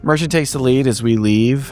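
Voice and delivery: ordinary talk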